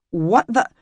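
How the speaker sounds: startled high sputter. A narrator's reading. Emmy spotting the man by her bed, startled sputter. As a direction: jolt out of it, caught off guard. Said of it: "she sputtered".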